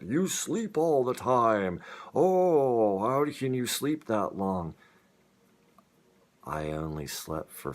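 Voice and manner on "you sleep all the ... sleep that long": high, loud, slow mocking voice of the people who scold; mocking the nagging critics. Mimicking everyone who told her she sleeps all the time, high and loud mockery. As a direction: sing it out in an exaggerated scolding whine to mock them. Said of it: "being told by everybody that".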